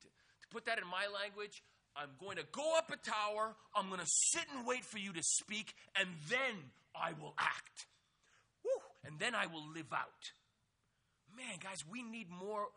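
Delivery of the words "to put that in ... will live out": bold preaching. Real, not acted. Preacher paraphrasing the prophet boldly.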